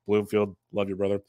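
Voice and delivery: usual speaking voice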